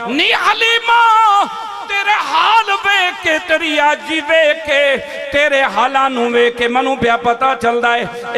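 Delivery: high, loud impassioned preacher's cry; full-force preaching. Acted. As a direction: proclaim it to the crowd, stretching the calls. Preacher voices the story at full force. (ऊंची आवाज़ विच जोशीला was)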